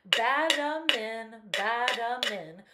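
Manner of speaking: slow, loud nonsense mumble; loud mumbling sounds. Acted. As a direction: mumble blindly with plugged ears. Iguana's nonsense noise.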